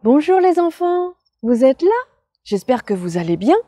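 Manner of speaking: put-on high, loud, bouncy kids' host; bright squeaky sing-song welcome.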